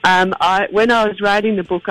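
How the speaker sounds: ordinary talk, nothing marked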